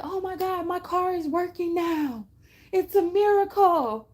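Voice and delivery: high, loud, laughing mock amazement; amazed gush. Helped stranger discovering a fixed car, giddy high amazement. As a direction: gush about a miracle, voice up high.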